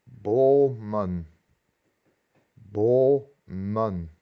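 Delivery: "bomen" in two slow syllables, long first O; long double vowel. Shown as a demonstration. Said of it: "pero se pronuncia doble".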